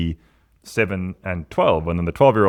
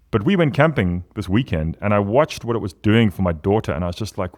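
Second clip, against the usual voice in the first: ordinary talk, nothing marked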